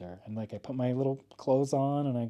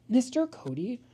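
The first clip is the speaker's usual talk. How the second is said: lightly voiced kid, higher; imitating a pupil asking, a bit higher; student asking her teacher, lightly voiced higher; 9 semitones higher than usual. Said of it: "some of the fucking kids would be like".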